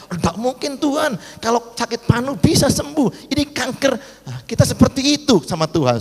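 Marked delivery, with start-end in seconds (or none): 0.1-3.9 high-pitched doubting objection; incredulous protest. Acted. Doubting believer objects to God about cancer, incredulous protest.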